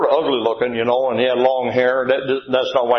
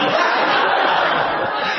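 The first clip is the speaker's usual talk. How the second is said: laugh. Real, not acted. Laughing after the shout.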